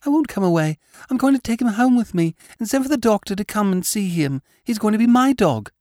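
put-on high, insistent child voice; a spoiled boy refusing to budge; stubborn small boy. A narrator's reading. Boy insists on keeping dog.

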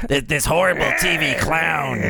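high, rough wheezing clown; raspy clown voice. Retold or imitated. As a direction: croak it out like a worn-out TV clown. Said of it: "that's where Dan got the voice".

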